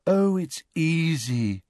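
put-on high, slow, airy girl's voice; easy reassurance. A narrator's reading. Luna to nervous Ron about mounting, easy reassurance.

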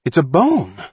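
high, loud, excited announcement. A narrator's reading. Johnny showing Mullen what he found, excited. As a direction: show off the discovery.

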